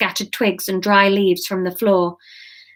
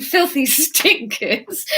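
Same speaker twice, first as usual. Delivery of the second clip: ordinary talk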